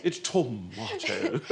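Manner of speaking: slow, drawn-out "tomato", tom-MAAH-toh; exaggerated British tomato. Host playing child correcting mother, slow British "tomato". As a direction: stretch "tomato" with a long AH, laughing.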